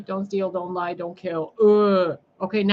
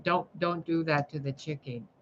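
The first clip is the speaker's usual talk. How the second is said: ordinary talk, nothing marked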